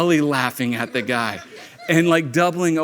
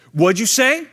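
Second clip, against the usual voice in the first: higher, louder mock challenge; confrontational bully; 8 semitones higher, 5 dB louder than usual. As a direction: imitate a bully picking a fight. Retold bully confronting him.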